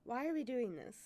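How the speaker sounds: ordinary talk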